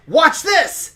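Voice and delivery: high, loud boast; showy call-out. Acted. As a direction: call the crowd's attention loudly. Character calls out before a stunt.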